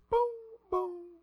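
sung-out high "boom, boom" doors chime; soft hollow two-note hoot; imitated train chime. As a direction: imitate a subway stop signal. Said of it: "And it says".

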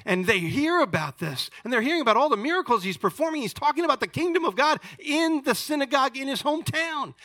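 fast, high-pitched preaching; full-force preaching. Real, not acted. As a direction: build up the excitement, rapid and high.

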